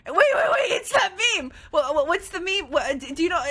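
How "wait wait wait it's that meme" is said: higher, louder excited yell; excited shout. Real, not acted. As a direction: shout it in sudden recognition. Recognizing a meme excitedly.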